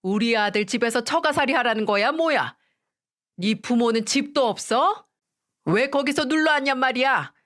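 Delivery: angry mother-in-law demanding answers. A narrator's reading. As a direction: scold down the phone, pitch climbing on the questions. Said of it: "화가 잔뜩 난 목소리로".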